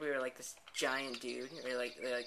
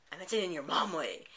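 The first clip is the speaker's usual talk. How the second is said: higher, louder smug retort; cheeky comeback; 5 semitones higher, 7 dB louder than usual. Acted. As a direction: toss it back smugly.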